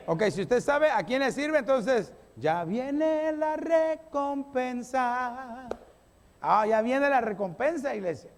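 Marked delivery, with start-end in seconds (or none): 2.4-5.7 drawn-out proclamation. Shown as a demonstration.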